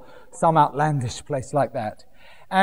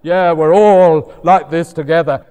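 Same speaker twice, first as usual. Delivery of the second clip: mock shrugging complacency; 4 semitones lower than usual. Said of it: "delight in your failings".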